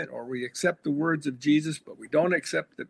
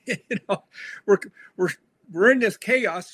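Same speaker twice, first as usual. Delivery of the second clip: mock-ironic, higher and louder, with a chuckle; sarcastic inner thought; 6 semitones higher, 4 dB louder than usual. Pastor mocking the tithing sermon idea with sarcasm. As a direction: poke fun at the cheap answer. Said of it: "And I thought".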